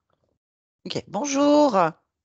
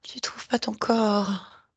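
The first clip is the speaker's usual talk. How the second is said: slow hushed question; slow whisper; slower than usual. Real, not acted. Guide whispers back the child's words.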